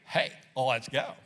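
low grudging "Hey, let's go"; reluctant. Retold or imitated. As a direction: sigh it out as a chore. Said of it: "Or it could be".

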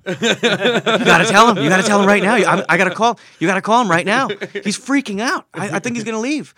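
loud, high imitation of a frantic producer; panicked urging. Producer urging the actor to confess before the star leaves, imitated loud and panicked.

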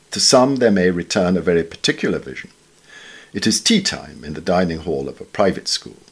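ordinary delivery

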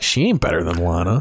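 low, scoffing fan verdict; dismissive scoff. Retold or imitated. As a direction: brush it off, unimpressed. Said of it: "you're like".

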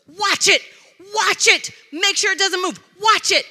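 urgent, high, loud commands; imitated yelling. Pastor ordering spouse to guard spider, mock urgent yell. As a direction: mimic someone yelling frantic orders. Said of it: "he's yelling".

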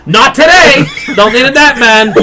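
loud, high mock outburst; hammed-up psycho shout at nobody. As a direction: call it out like a crazy person. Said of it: "out loud, like a psycho now".

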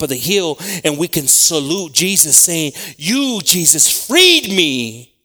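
high shouting preacher climax; preaching at full force. Real, not acted. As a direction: cry it out to the rafters.

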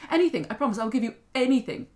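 loud, high urgent promise; acted desperate plea; desperate young woman. Girl begging the little man.

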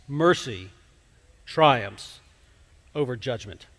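solemn scripture recital; scripture reading. Acted. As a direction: read the verse with weight, pausing between phrases. Reads scripture passage aloud.

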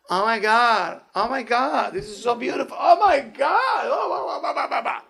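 hammed-up, high-pitched gushing; mock rapture. Rabbi parodying a dazzled synagogue visitor, mock rapture. Acting out thrill at decorated synagogue.

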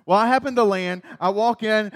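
normal talking voice

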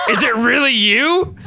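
high, slow, hammed-up disbelief; mock astonishment. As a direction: draw it out melodramatically. Astonished reunion in story.

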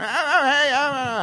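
loud, high-pitched celebrity impression; high booming star impression; Tom Hanks. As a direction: call it out high and loud from another room. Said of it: "That's a great Tom Hanks impression".

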